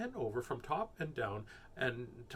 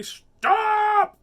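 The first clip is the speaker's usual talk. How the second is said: piercing high shout; very loud yell; 16 dB louder than usual. A narrator's reading. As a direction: yell it out at full force. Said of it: "Taya yelled very loudly".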